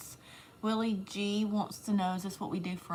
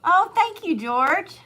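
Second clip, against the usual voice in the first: loud, high thank-you; effusive thanks; 9 semitones higher, 12 dB louder, slower than usual. Real, not acted. Woman thanking George on the stream, effusively.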